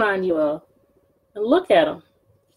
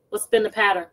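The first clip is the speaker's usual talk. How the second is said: plain reading voice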